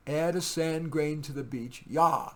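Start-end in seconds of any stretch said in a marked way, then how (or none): none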